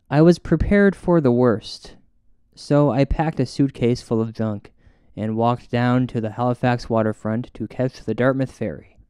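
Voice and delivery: ordinary talk, nothing marked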